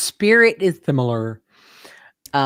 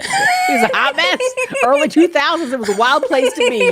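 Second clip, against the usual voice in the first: laughing; 11 semitones higher than usual. Real, not acted.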